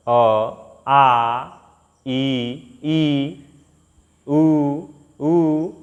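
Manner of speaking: आ and ई stretched with the mouth wide open; proper vowel recitation. Shown as a demonstration. A teacher demonstrates the vowel pattern. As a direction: pronounce each vowel fully and separately. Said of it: "इस तरह से जो है ना पढ़ा जाता है".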